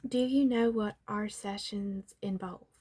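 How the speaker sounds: ordinary delivery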